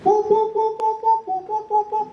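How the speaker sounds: high popping mouth sounds, pop pop pop; imitated pop sounds. Shown as a demonstration. Teacher showing pupils a sound, high popping noises. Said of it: "bunyi macam mana?".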